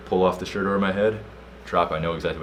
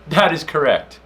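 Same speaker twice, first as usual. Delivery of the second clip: high, loud, hammed-up answer; emphatic confirmation; 9 semitones higher, 7 dB louder, slower than usual. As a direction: declare it like a proud contestant. Acted reply to the policeman.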